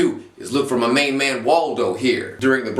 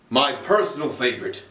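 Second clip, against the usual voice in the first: ordinary delivery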